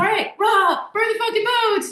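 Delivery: high rah-rah battle cry; rally the troops, high and keyed up; fired up. Acted. Rallying everyone to burn the boats, fired up. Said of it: "all fired up".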